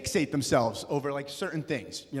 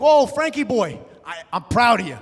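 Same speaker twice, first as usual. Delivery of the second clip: proud deli guy; 6 semitones higher, 9 dB louder than usual. Retold or imitated. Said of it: "He's like".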